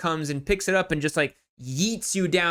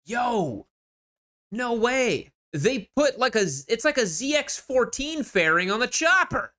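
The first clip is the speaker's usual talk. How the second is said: real high-pitched astonishment; amazed disbelief; 7 semitones higher than usual. Real, not acted. Host spotting the fairing on a chopper, high amazed disbelief. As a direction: react to an unbelievable discovery.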